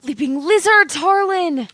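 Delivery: loud startled cry at the Tower card; shocked exclamation. Acted.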